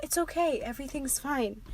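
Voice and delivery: very soft, hushed reassurance; acted soothing. Wounded boy calms the crying girl, hushed soothing. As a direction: soothe in barely voiced tones.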